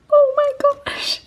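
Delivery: high whispered gasping oh my gosh; breathless squeal. Real, not acted. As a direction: gasp it out at the reveal. Big reveal of the palette.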